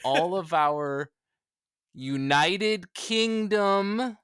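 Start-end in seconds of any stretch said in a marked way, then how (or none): 1.9-4.2 UNITED KINGDOM stretched very slowly, pitched up; playfully drawn out. Pretend. Guest addressing British listeners after falling into the trap, drawn out. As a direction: draw out each syllable of United Kingdom in a raised mock-grand voice.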